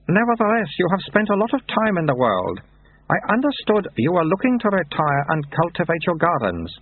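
put-on high, childlike abbot voice; baby abbot. A narrator's reading. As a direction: speak like a toddler with an old man's words.

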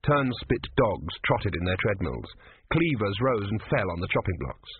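ordinary delivery

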